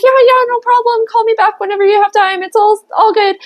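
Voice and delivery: breezy client. Retold or imitated. Client brushing it off cheerfully. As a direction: chirp like a friendly client.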